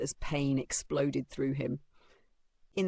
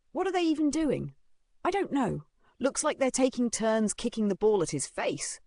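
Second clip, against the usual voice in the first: put-on higher girlish voices, idly curious; lightly voiced girl onlookers; muttering girls; 8 semitones higher than usual. A narrator's reading. Girls nearby watch the bullying, idly commenting. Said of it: "girls sitting nearby yawned and muttered".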